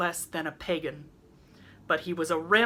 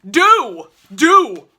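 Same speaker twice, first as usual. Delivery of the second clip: high, loud roar; booming furious roar; 7 semitones higher, 15 dB louder than usual. A narrator's reading. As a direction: roar the dare at him. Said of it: "roared Norman".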